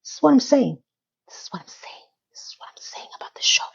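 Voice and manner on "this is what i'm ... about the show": whispered aside, much softer. Shown as a demonstration. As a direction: breathe the words conspiratorially. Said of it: "repeats it whispered".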